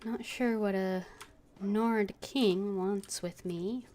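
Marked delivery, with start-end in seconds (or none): none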